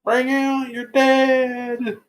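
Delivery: high, slow calling out; plague cart call. Retold or imitated. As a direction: cry it out like a plague collector.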